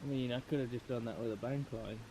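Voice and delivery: hushed whisper so fish aren't spooked. Real, not acted. Whispering while fishing at night.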